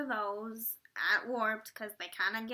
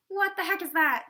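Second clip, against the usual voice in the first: higher, louder mock confusion; mock surprise; 6 semitones higher, 6 dB louder than usual. Band baffled by a curtain that won't drop, mock surprise. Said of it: "they were just like".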